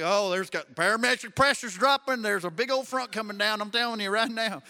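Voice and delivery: loud mock country forecaster accent; put-on folksy accent. Pastor joking he now talks like those people, in folksy accent. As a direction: ham up the folksy weather-prophet accent. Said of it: "I talk that way".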